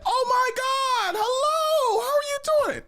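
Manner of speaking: very high, loud, hammed-up sister welcoming a guest; sister's gushing greeting. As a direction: squeal it like an overexcited hostess. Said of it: "And then she's like".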